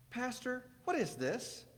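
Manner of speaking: put-on high childlike question; little boy voice. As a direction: voice a small curious boy.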